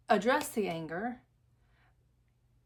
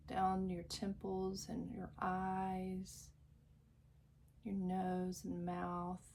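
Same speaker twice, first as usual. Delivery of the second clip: guided meditation; 6 dB quieter than usual. Acted. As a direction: lull the listener into calm, drawing out each phrase. Leads a guided visualization.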